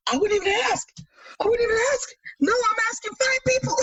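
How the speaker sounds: high, squeaky flustered protest; mock panic. Daughter correcting dad about the amount, high mock panic. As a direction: re-enact a flustered plea, pitched far up.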